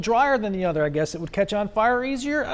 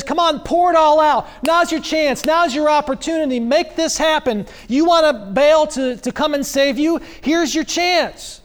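higher-pitched goading, egging them on; sarcastic challenge, pitched up and pushy; mocking taunt; 5 semitones higher than usual. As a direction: taunt the prophets like a heckling coach. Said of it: "where Elijah says".

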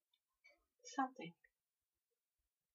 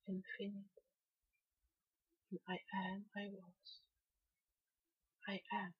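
soft channeling; 8 dB quieter than usual. Acted. Channeler gently addressing the listener in a hushed meditation. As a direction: breathe each phrase out softly, like a trance.

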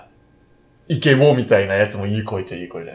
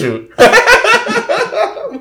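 drawn-out, squeaky mock kiss sound; laughing, hammed-up smooch; 3 dB louder, much slower than usual. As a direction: stretch the kiss up high. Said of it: "無理無理無理".

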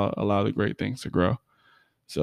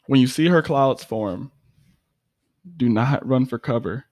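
poem recital; 3 semitones higher than usual. Acted. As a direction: perform it like a spoken word poem. Recites her own poem.

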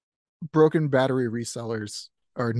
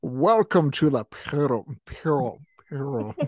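laughing through a mock Connery voice; hammed-up Connery, cracking up; Sean Connery impression; 2 semitones higher than usual. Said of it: "Do that. Say that".

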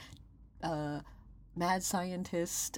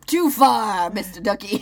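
mock scolding; 10 dB louder, slower than usual. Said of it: "I go".

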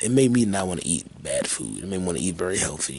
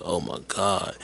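normal talking voice

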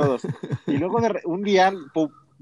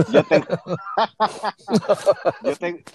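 breaks into laughter mid-sentence; words swallowed by a laugh; 2 semitones higher, 3 dB louder than usual. Real, not acted. Laughing at the PlayStation joke about the air fryer.

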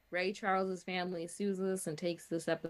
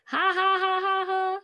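high, loud, monotone "ha ha ha"; mock laugh; 14 semitones higher, 8 dB louder than usual. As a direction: recite a fake laugh on one high pitch. Reads out a tweet's typed laughter.

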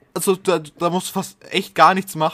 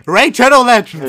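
loud, high announcer shout; 7 semitones higher, 11 dB louder than usual. Pretend. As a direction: belt it like a YouTube ad read. Shouted sponsor name.

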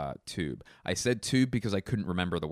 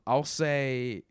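usual speaking voice

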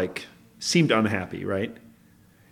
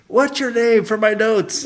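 high, loud, drawn-out call; yelling over; 11 semitones higher, 7 dB louder, slower than usual. Pretend. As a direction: holler it slowly to someone across the way. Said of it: "and you yell over".

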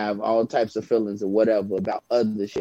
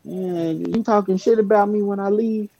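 high, mock suspicious question; suspicious accusation; 8 semitones higher than usual. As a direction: needle them like a two-faced friend.

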